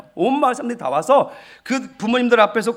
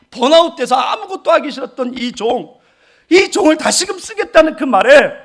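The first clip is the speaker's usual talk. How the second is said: louder, rising sermon climax; impassioned preaching; 4 semitones higher, 5 dB louder than usual. Acted. Pastor telling the congregation why he wept, impassioned preaching. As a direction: preach it out with full conviction.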